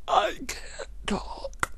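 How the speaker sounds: high, slow voiceless croak; high cracked voice with no sound behind it. Retold or imitated. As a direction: fake a lost voice, straining to get words out.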